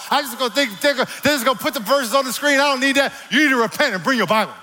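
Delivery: high, loud, rapid-fire preacher; full-force preaching. Acted. Preacher mimicking churchgoers' excuses, high loud preaching. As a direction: preach it high and urgent, racing through objections.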